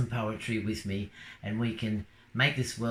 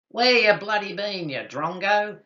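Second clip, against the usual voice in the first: loud, higher bloke's hail across the bar; rowdy pub greeting, louder and higher; calling out; 9 semitones higher, 6 dB louder than usual. Acted. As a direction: holler at your mate across a crowded pub. Bloke hailing mate in pub.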